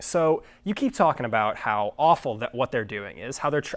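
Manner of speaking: normal talking voice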